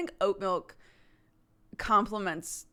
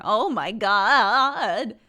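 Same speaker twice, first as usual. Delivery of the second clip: drawn-out oh my God; 8 dB louder, much slower than usual. Acted. Hammed exclamation after cartoon voice.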